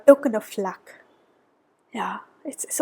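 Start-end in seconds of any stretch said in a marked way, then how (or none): 1.9-2.8 tender whispered aside; whispering. Real, not acted. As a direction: whisper it with feeling. Touched by the vision.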